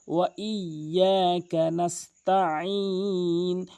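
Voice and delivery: slow ওয়া ইয়াকা নাস্তাইন with double y; drawn-out chanted ওয়া ইয়াকা নাস্তাইন; correct recitation. Shown as a demonstration. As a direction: hold ইয়া and the last vowel in ওয়া ইয়াকা নাস্তাইন. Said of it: "double উচ্চারণ করতে হবে".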